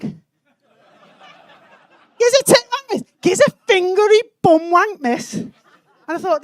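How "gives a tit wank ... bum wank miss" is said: higher, louder cheeky shout; car park heckle. Acted. Someone yelling across the car park to teacher, louder heckle. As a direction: holler it insistently at miss. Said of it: "across the car park I heard".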